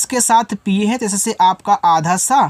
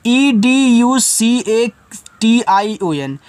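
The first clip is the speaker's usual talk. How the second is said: slow, letter-by-letter spelling; 2 semitones higher, much slower than usual. Shown as a demonstration. Teacher spelling a word to students, slowly. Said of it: "देखो, टी आई ओ एन को पढ़ते हैं".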